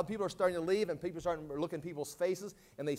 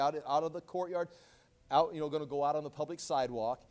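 usual speaking voice

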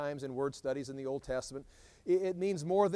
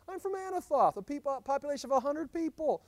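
pitched well higher, a protesting plea; pleading protest; 9 semitones higher than usual. Retold or imitated. Jeremiah protesting to God about his small hometown, pitched high. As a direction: sound small and overwhelmed, voice climbing.

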